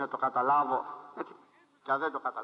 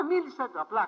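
ordinary delivery

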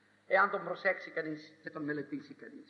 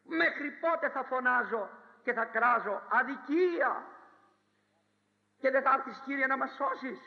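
higher, pleading cry to God; prophet's complaint; 5 semitones higher than usual. Acted. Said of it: "φώναζε ο προφήτης". (παραπονιάρικη κραυγή προφήτη)

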